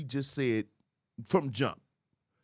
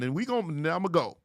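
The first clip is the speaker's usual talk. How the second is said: ordinary delivery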